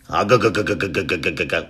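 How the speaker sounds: throaty chugging engine noise; imitated motorbike engine. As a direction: chug like a Harley, sinking in pitch. Imitates Harley riding off.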